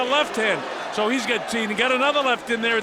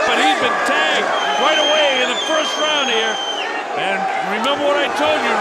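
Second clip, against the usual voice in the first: loud excited play-by-play; 6 semitones higher, 6 dB louder than usual. Real, not acted. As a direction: erupt as the punch lands. Knockdown called live.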